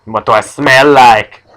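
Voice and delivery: loud, slow teasing call; mock taunting. Bullies teasing a man with no nose, mock taunting. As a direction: stretch it out loudly like a jeering heckler.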